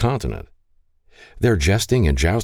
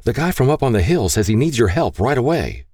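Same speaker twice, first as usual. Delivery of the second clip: put-on higher, mildly urgent boy; lightly acted urgent message; urgent calling; 6 semitones higher than usual. A narrator's reading. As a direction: report it with a touch of urgency. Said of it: "called as he burst through the front door".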